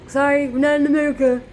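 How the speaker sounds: put-on snippy shopkeeper; mock rude retort. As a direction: mimic a shopkeeper brushing you off.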